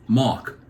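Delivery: "Mark" repeated as "Mahk"; R dropped. Retold or imitated. East Coaster repeating his name for a clerk, "Mark" without R. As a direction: repeat "Mark", still leaving out the R. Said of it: "we don't pronounce our R's and L's".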